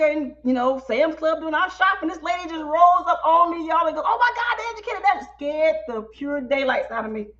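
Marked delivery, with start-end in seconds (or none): 4.1-5.0 high, gushing recognition; high-pitched mock squeal of delight; starstruck fan. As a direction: imitate an excited stranger. Excited stranger rushing up, retold.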